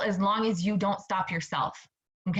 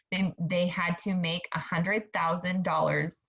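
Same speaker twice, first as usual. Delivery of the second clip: ordinary talk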